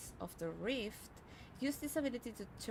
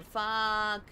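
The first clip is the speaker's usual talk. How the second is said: frustrated; 3 semitones higher, 10 dB louder than usual. Real, not acted. Mages spotted.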